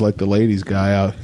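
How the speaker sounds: usual speaking voice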